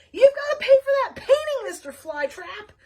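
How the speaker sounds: high, loud scream. Acted. Lulu Emu screams at Flytrap from the bus, high and loud. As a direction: shriek it after him. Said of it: "Lulu Emu screamed".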